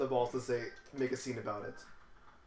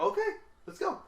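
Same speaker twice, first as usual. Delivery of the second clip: very high, bright let's go; mock chipper. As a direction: sing it out with fake cheer. Sly reply to coworker.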